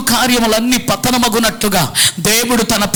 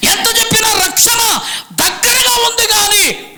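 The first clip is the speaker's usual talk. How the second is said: fiery preaching building to a shout; 7 semitones higher than usual. Acted. Pastor rebuking the man who won't listen, rising to a shout. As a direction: pound it harder each time you say ఎంత చెప్పినా.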